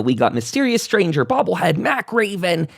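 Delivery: ordinary delivery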